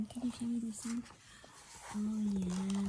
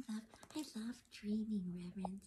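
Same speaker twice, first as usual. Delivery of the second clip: whisper; 5 semitones lower, 6 dB quieter, faster than usual. Real, not acted. Answers softly after the reading.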